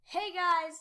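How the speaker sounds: ordinary talk, nothing marked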